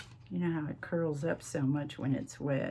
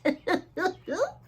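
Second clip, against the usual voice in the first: a burst of laughter, much higher; high squeal of laughter; 13 semitones higher, 4 dB louder than usual. Real, not acted. Crafter laughing at hearing her own accent, high squealing laugh.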